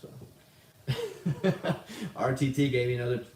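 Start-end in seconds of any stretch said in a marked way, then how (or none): 0.9-2.1 high burst of laughter; real amused laugh. Real, not acted. Laughs at a donation message.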